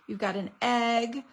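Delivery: usual speaking voice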